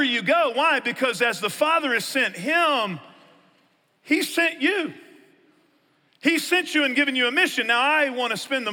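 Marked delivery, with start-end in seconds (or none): 4.0-4.9 much higher, stress on you; emphatic. Real, not acted. Pastor telling listeners they are sent: high emphatic he sent you. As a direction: hit you hard and high. Preacher climax on mission.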